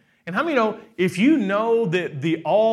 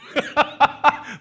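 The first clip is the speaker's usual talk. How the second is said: bright amused laugh, pitched up; short real laugh; 9 semitones higher, 4 dB louder than usual. Real, not acted. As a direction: laugh out loud at the story. Laughs at his own retelling.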